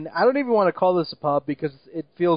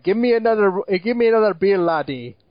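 put-on raised, louder stage-Irish drunk demanding beer; mock Irish drunk; 3 semitones higher, 4 dB louder than usual. Mocking the drunk Irish villain's demand, stage Irish accent. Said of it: "he's like".

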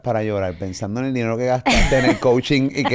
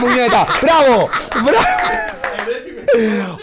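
imagined director's cheer; 9 semitones higher, much slower than usual. Pretend. Host imagining Spielberg cheering her, high and excited. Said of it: "me imagino que Steven".

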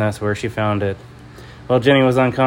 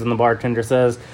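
usual speaking voice

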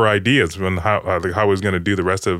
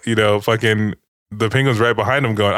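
usual speaking voice

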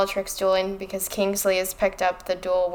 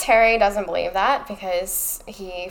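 ordinary talk

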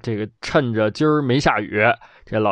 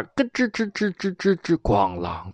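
creaking gate; 4 semitones higher, 5 dB quieter than usual. Acted.

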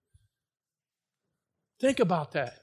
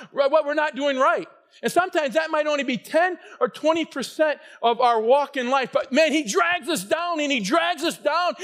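preaching; 5 semitones higher than usual. Real, not acted. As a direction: drive it home with rising force. Preaching at full force.

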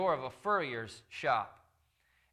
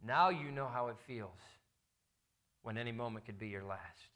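quiet reveal; 6 semitones lower, 8 dB quieter than usual. Acted. Emperor's quiet lesson to furrier.

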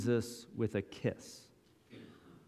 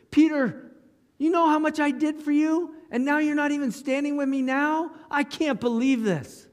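much higher, louder wounded anger; hurt outrage; 12 semitones higher, 3 dB louder than usual. Pretend. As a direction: scold Peter like a betrayed friend. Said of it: "What if he looked at Peter?".

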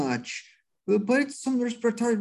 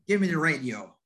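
ordinary delivery

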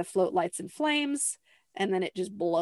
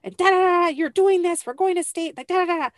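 high, loud, hammed-up pushy mother; shrill nagging mom rant, played for laughs; 11 semitones higher, 9 dB louder, faster than usual. Mother ordering her daughter onto the float, mock high and loud. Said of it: "Gladys is like".

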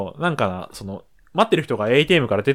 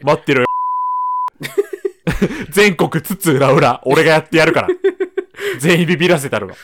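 mock tough boast; 3 semitones higher, 3 dB louder than usual. Playful boast about scaring salesmen.

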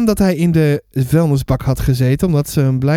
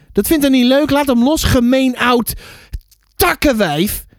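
put-on child's protest, raised pitch; mild angry shout; 6 semitones higher than usual. A narrator's reading. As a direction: scold the neighbour in a higher voice. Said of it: "schreeuwde ik". (boos protest, licht geroepen)

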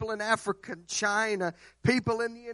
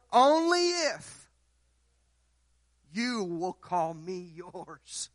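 slow, drawn-out, tender and hushed; slow solemn voice of God; slower than usual. Acted. Preacher voicing God's answer to the congregation, slow and tender. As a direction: let each word fall slowly and gently.

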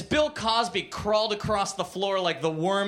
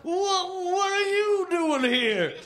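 high, drawn-out sputtering alarm; slow, squealed mock panic; panicked Cosby; 11 semitones higher, slower than usual. A narrator's reading. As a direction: stammer it in a cartoonish high voice. Cosby startled by his accuser.

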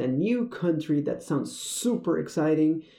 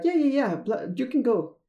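higher-pitched mock clerk brushing off doubt; breezy retold reassurance, pitched up; dismissive reassurance; 8 semitones higher than usual. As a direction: imitate a clerk waving someone through. Clerk waves away her doubt.

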